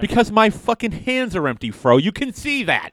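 high, exasperated slow whine; mock exasperation. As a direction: act fed up and whiny. Exasperated rant, mock anger.

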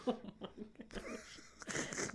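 soft, slow, near-whispered oh my gosh; breathless disbelief. Real, not acted. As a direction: gasp it out after laughing.

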